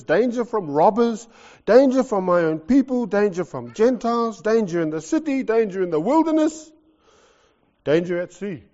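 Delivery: loud, rising, emphatic list of dangers; preaching build. Real, not acted. Preacher to congregation, listing Paul's dangers in a loud build. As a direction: hammer each danger harder.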